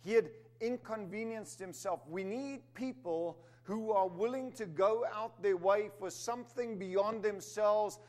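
firm, rising sermon delivery; preaching. Acted. Preacher calling the church to collective strength, firm. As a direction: press the point home to the congregation.

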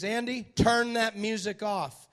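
a hint of a stern warden; warden's order. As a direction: bark it like a mean warden. Warden orders Andy over music.

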